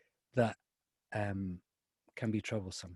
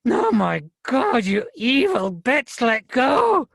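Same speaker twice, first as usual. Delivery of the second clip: loud, high, drawn-out scream; pained scream; 11 semitones higher, 8 dB louder, much slower than usual. A narrator's reading. Fergus screams at the girl biting his ankle, agonised yell. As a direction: scream it out slowly, much higher and louder. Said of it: "Fergus screamed".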